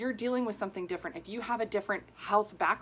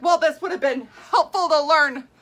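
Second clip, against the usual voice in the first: loud, high, laughing through it; half-laughing, half-outraged at the doctor; laughing exasperation; 8 semitones higher, 11 dB louder than usual. Retold or imitated. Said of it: "I was like".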